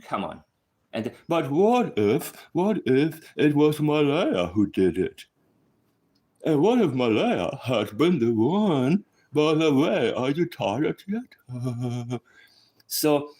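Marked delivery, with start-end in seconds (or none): 1.3-12.2 slow, exaggerated put-on voice, louder; mocking rival's theory. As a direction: ham up her endless what-ifs. Mock-quoting a rival's theories.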